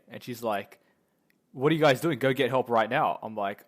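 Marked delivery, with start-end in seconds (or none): 1.5-3.2 urgent angry order. Retold or imitated. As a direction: yell it like a teacher demanding help. Injured teacher ordering pupils to help.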